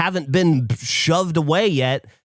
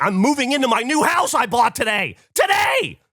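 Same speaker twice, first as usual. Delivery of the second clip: exasperated shout; 7 semitones higher than usual. Retold or imitated. Telling the bank off, shouting the last word high. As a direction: yell it, the last word highest.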